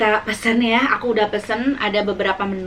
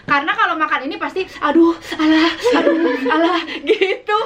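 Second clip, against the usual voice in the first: mock spicy wailing; 4 semitones higher than usual. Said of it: "kenapa dikasih nama luhlah".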